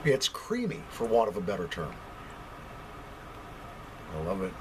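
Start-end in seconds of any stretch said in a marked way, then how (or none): none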